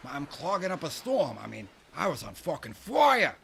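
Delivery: high, loud bragging; loud, fired-up boast; excited boasting. Real, not acted. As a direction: crow about it, building to a shout. Bragging about wild clog dancing.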